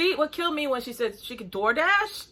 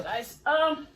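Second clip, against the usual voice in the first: ordinary delivery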